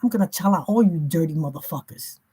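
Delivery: normal talking voice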